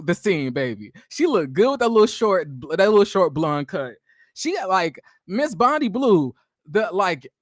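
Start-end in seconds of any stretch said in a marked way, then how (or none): none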